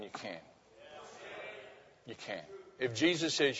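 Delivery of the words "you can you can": hushed echo. Acted.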